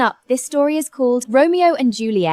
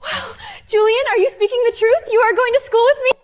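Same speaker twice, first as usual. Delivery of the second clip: overjoyed; 13 semitones higher than usual. Acted. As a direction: squeal with joy at his promise. Overjoyed at Julian's promise.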